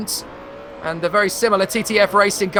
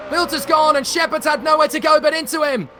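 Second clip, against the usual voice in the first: high, urgent race call; excited commentary; 6 semitones higher than usual. Real, not acted. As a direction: call the crash at full excitement. Live call of crash.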